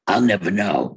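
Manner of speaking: ordinary delivery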